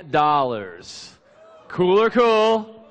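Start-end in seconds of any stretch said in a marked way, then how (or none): 1.7-2.8 excited hype. Acted. Catchphrase repeated, hyped to audience.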